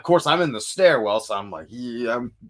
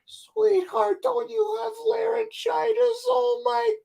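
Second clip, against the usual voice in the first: put-on very high, worried little old lady; much slower than usual. Singer imitating a little old lady feeling his throat, high and fretful. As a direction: fret like a sweet old granny, drawing out oh my god. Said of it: "she's like".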